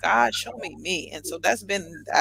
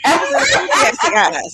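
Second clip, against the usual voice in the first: high, loud, laughing protest; laughing defence; 8 semitones higher, 10 dB louder than usual. Real, not acted. Storyteller defends her eager look to host, laughing defence. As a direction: insist loudly through a laugh.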